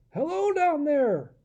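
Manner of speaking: high, slow, far call. Acted. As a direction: hail the king from above. Adam calling from the sky.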